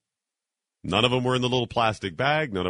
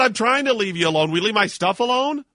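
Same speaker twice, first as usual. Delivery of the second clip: much higher, louder snapping comeback; angry retort; 11 semitones higher, 5 dB louder than usual. Retold or imitated. Traveller arguing back at the man at security, angry. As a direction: fire it right back at him.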